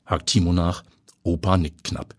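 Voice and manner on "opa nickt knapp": ordinary delivery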